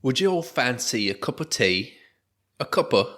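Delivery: a light mock Australian accent; put-on Australian accent. Offering co-hosts tea in a mock Australian accent. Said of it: "like, fucking Australian".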